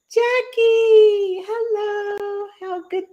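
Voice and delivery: high, bright sing-song hello; excited greeting. Real, not acted. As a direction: greet her with delight.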